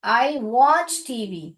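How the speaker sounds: drawn out word by word as it is written; slow each word as if writing on the board; teacher dictates a sentence very slowly; very slow dictation. Shown as a demonstration. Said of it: "मैं लिख के बता रही हूँ".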